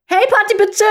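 high brash mock greeting; loud party greeting. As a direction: call it out like a party host. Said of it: "er ist eher da so hingegangen".

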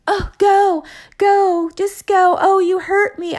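high-pitched, whiny mimicry of her pleas; mocking imitation. Partner throwing her pleas back at her, mocking. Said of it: "mocking me and being like".

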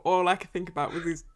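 ordinary talk, nothing marked

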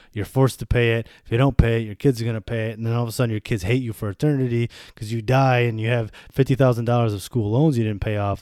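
ordinary delivery

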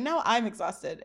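normal talking voice

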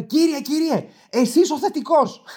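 high, drawn-out calling voice; put-on high pharmacy assistant; imitated calling clerk. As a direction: call out to a customer in a mock clerk voice. Second clerk calls him out.

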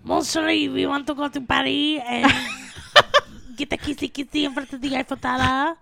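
put-on a romantic Parisian caricature in a French accent; mock French accent. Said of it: "you like my French accent".

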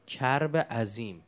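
slow stress. Shown as a demonstration. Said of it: "اسم اینو خدا گذاشته چی؟ بگید".

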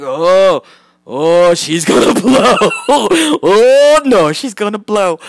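loud, drawn-out hammed-up warning cry; mock eruption alarm. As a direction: holler it like a volcano about to erupt. Mock volcano about to erupt.

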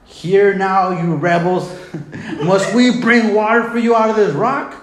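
shouted scriptural rebuke; yelling at them. Acted. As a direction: cry it out high and forceful, indignant. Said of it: "He begins to yell at them".

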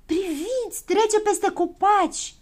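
high, drawn-out exclamation; excited calling out. A narrator's reading. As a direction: point it out in amazement. Character calls out a sighting.

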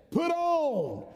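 high, ringing "Put on."; emphatic command. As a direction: hit "Put on." hard and high. Preacher repeats scripture command.

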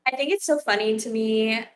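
ordinary talk, nothing marked

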